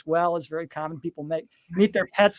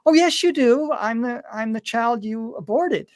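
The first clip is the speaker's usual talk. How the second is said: put-on higher, childlike insistence; a child's voice, raised in pitch; little girl voice; 4 semitones higher, slower than usual. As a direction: voice the small daughter gently correcting her mother. Child's reply in retold story.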